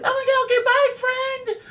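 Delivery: very high squealed goodbye; drunken party girls. Retold or imitated. Tipsy bachelorettes saying goodbye to him, high squeal. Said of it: "went in their little drunken way".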